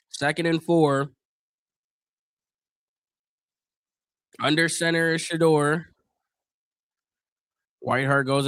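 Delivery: calm, even broadcast call of the down; measured play-by-play voice; live play-by-play. Acted. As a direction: read the play like a TV announcer. Calls the game live.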